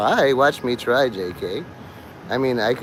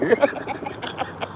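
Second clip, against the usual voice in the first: high airy laugh; 10 semitones higher, 6 dB quieter than usual. Real, not acted. Laughs after skit.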